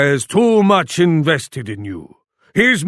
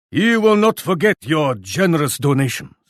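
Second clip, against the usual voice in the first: ordinary delivery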